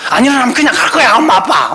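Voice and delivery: high, hurried empty threat; mock parental threat. Parent threatening to leave sleepy children behind, high mock warning. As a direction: rattle off a hammed-up threat to leave. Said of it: "막 협박 막 해요".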